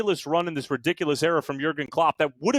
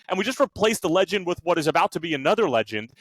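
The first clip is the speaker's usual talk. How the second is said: ordinary delivery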